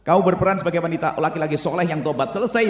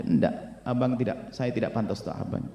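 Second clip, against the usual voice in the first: low, very soft protest; unworthy wife; 3 semitones lower, 8 dB quieter than usual. Acted. Wife refusing husband's praise, meek and soft. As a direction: murmur it, shrinking from the praise.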